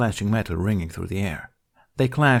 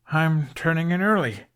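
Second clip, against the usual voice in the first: fake sleepy excuse; 8 semitones higher than usual. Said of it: "Hiccup yawned again for emphasis".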